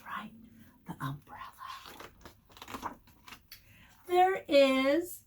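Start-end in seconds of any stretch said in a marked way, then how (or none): 0.0-3.0 hushed whisper of the answer. Acted. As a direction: breathe the word like a secret. Reading book to children.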